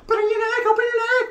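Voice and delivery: very high squeaky put-on voice; absurdly high mimicry; mock squealing. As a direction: squeal it in a cartoonish falsetto. Acted squeaky voice in the quarrel.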